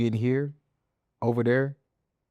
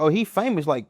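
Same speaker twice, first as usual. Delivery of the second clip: lightly mimicked friends hyping him, higher and louder; mock-excited talking him up to the cashier; 6 semitones higher, 4 dB louder than usual. Friends talking him up to staff for free food, high and loud.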